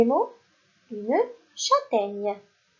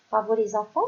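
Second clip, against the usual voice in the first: usual speaking voice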